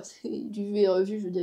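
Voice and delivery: normal talking voice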